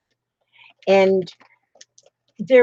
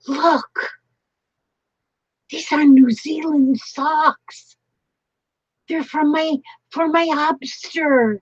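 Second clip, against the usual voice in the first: thrilled discovery; 6 semitones higher than usual. Real, not acted. Showing the New Zealand socks for her hubster, thrilled.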